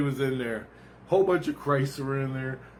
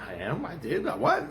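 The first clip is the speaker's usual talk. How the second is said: baffled questions climbing to a high-pitched "what"; acted disbelief; 5 semitones higher than usual. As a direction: play it stunned, voice jumping up at the end. Acting out his stunned reply.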